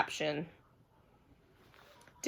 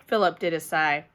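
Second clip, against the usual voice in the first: usual speaking voice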